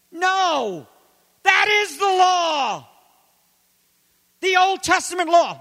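high, slow, loud proclamation; emphatic shout. Real, not acted. Preacher declaring to the congregation that this is the law. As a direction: bellow it, drawn out.

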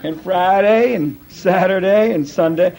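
slow drawn-out imitation of J. Vernon McGee; lightly voiced slow old preacher finishing the list; slow McGee drawl. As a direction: drag out the last days slowly. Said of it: "it took him a long time to say it".

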